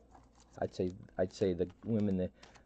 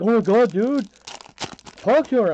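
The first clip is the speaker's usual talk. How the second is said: high, loud urging friend; egging-on buddy; 13 semitones higher, 10 dB louder than usual. Retold or imitated. Friend Carl urging him to talk to her, loud and high. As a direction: push your buddy to go for it. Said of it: "Carl's like".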